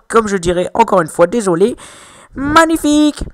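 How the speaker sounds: put-on French gamer hosting a top ten, hamming a Chinese accent; mock Chinese accent. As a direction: play caster in a hammy fake Chinese accent. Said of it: "Désolé pour mon petit accent chinois".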